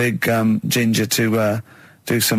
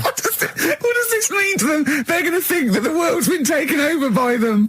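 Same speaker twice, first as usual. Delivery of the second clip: high, breathless laughing protest; squeaky giggling disbelief; 11 semitones higher than usual. Real, not acted. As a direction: laugh your way through the outrage. Laughing disbelief at the spider news.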